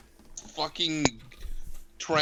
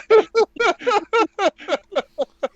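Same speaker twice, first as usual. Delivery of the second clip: high villainous cackle; evil cackle; 14 semitones higher, 5 dB louder than usual. Acted. Evil dragon laugh at cub.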